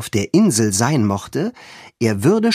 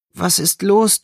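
sleepy; 9 semitones higher than usual. A narrator's reading. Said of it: "fragte Anne schläfrig".

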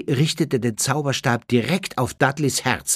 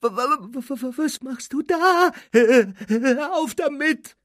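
high, stammering frightened voice; fearful quavering plea; terrified; 13 semitones higher than usual. A narrator's reading. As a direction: stutter it out in high panic. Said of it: "Dudleys angsterfüllte Stimme".

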